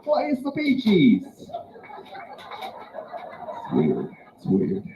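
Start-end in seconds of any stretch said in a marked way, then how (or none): 0.0-1.2 high, giddy German party-goer; hammed-up German accent, pitched way up; happy German accent. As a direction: squeal for a night out in comic German. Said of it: "that's my German accent".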